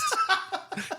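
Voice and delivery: burst of laughter. Real, not acted. Laughing at Ricky Bobby joke.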